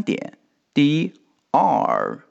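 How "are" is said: "are" said slowly with a curled retroflex r. Shown as a demonstration. Teacher shows students the curled r in "are". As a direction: stretch "are" and roll the tongue back on the r. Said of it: "要有R的卷舌".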